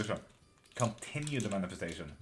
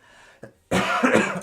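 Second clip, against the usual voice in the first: sharp, loud hacking cough; 11 semitones higher, 14 dB louder than usual. Real, not acted. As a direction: cough hard and suddenly. Sudden loud cough on stream.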